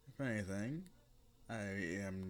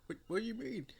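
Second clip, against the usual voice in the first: much higher, baffled question; confused squeak; 14 semitones higher, much faster than usual. Acted. Boy confused by the mentor, high baffled question. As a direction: sound lost and startled.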